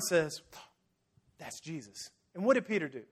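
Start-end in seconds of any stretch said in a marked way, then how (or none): 1.4-2.1 very soft, low murmur; hushed realization. Retold or imitated. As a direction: breathe it out in quiet awe. John realizing it is Jesus.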